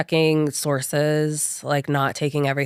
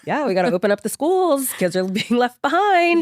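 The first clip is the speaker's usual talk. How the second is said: hammed-up rally speech, higher and louder; mock politician; 10 semitones higher, 5 dB louder than usual. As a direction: parody a campaign slogan. Mock political slogan, laughing.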